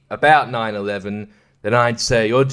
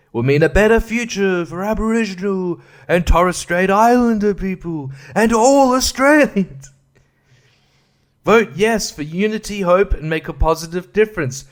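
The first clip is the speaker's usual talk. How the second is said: upbeat booklet voice, pitched higher; mock campaign read; 6 semitones higher than usual. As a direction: sell the yes case like an ad, high and bright. Reading campaign slogan.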